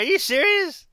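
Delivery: can't believe his luck; disbelieving. Retold or imitated. Told on the phone he won, disbelieving.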